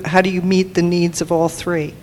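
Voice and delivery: normal talking voice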